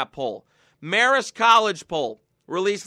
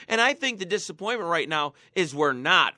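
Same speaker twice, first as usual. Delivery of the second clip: usual speaking voice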